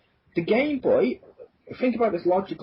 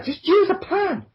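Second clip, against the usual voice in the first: exasperated wife; slower than usual. Acted. Wife catching husband cutting out letters, exasperated.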